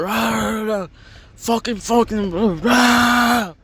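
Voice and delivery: high-pitched garbled mock yelling; mock screaming. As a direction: imitate a screaming row in wordless rahs. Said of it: "like, screaming at her mom".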